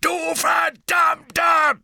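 high, slow, furious roar; gebrüllt. Acted. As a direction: bellow it at the top of your voice. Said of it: "brüllte Hagrid". (wütend brüllen)

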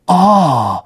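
be taken with the sight; fascinated. A narrator's reading. Colin staring at Ron's slugs, fascinated. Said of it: "sagte Colin fasziniert".

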